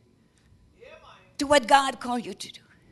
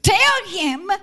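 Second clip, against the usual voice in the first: ordinary delivery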